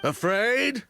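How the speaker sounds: high, incredulous "Afraid?" then a scornful laugh; scoffing disbelief. Acted. Prisoner mocks the idea he fears the poisoner, scoffing. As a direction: throw the word back with contempt.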